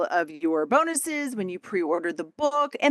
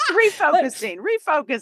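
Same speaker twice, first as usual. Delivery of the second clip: laughing; 8 semitones higher, 4 dB louder than usual. Real, not acted. Laughing echo.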